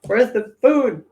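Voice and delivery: cat's pushy little voice; voicing the cat. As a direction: play the hungry cat demanding food. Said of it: "She's probably like".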